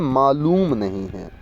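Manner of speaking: मालूम said slowly with a long ऊ; slow corrected word. Shown as a demonstration. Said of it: "मालूम नहीं होता है". (मालूम में लंबा ऊ खींचकर)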